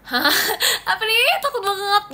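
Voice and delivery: high startled squeal of fright; jumpy mock fright with a laugh; startled gasp. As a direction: act out the sudden shock, up high. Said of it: "jadi kayak".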